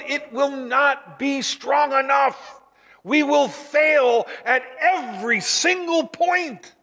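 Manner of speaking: slow, high, shouted warning; preaching shout. Real, not acted. Preacher warns the congregation of failure in a slow shout. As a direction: hammer each word out slowly. Preacher driving home human weakness.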